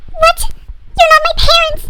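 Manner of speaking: high, loud melodramatic shriek; hammed-up horrified discovery; shocked outburst. Daughter discovers she is adopted.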